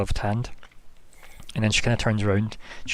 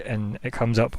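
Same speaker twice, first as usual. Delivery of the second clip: ordinary delivery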